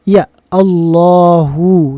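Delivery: Allahu drawn out slowly, every syllable clear; over enunciating. Shown as a demonstration. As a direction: read Allahu slowly and carefully, ending in u. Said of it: "maka silahkan Anda baca".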